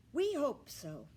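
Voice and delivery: a firm, steady reassurance. A narrator's reading. Ernest firmly answering Millie's worried question, firm. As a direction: say it firmly to settle her. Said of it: "he said firmly".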